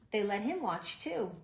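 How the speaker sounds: plain reading voice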